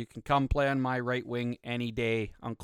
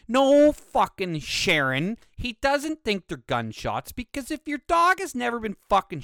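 loud, high ranting rebuttal; exasperated retort; 8 semitones higher, 5 dB louder than usual. Acted. Ranting back at a complaining dog owner, loud exasperated retort.

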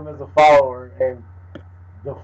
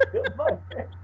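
much higher and softer echo; squeaky echo; 9 dB quieter, much faster than usual. Pretend. Friend repeats the joke in a much higher, softer squeak. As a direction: squeak it back, half under the breath.

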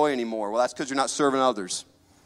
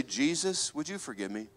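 quiet prayer; 8 dB quieter than usual. Acted. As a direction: drop to a soft, humble plea. Sinner's quiet prayer voiced.

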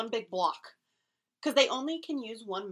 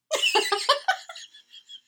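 loud high laugh; 13 dB louder than usual. Real, not acted. Laughing at the message.

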